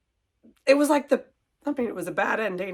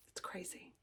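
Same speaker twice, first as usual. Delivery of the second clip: barely voiced through tears; tearful whisper; 3 semitones lower, 22 dB quieter than usual. Real, not acted. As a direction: whisper it, fighting back tears. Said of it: "I don't know why I'm so crying".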